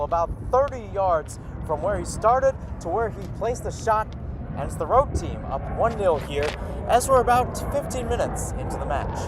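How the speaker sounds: rising high and loud into the goal call. Real, not acted. Goal being scored live.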